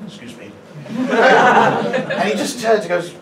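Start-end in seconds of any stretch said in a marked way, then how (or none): 0.1-0.5 very soft, low request; timid interruption. Retold or imitated. Self-styled alpha male confronting a drug user, tiny soft voice. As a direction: barely dare to say it, softly. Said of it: "deal with it in the most alpha male possible way".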